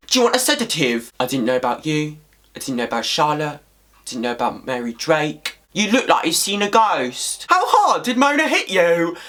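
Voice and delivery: mocking, exaggerated Cockney impression; shitty Cockney accent. British fan mimics the twin's terrible lines, bad Cockney accent. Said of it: "some shitty Cockney accent".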